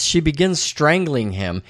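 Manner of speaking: normal talking voice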